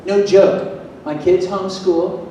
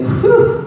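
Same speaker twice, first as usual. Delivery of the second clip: high airy exhale whew; audience whew; 7 dB louder than usual. Shown as a demonstration. Speaker leads the crowd in a high breathy whew. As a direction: let out a big relieved whew. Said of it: "Everyone say".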